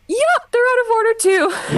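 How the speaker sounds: high bright polite staff reply; fake cheerful staff voice. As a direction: chirp it sweetly with a straight face. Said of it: "So I just went".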